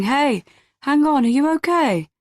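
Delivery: high, concerned call after someone; concerned man calling. A narrator's reading. Man follows his fleeing date out of pub, concerned call. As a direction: call after them, worried.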